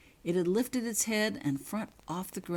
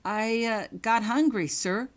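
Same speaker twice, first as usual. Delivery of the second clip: nervous; 6 semitones higher, 6 dB louder than usual. Acted. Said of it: "answered Holly truthfully".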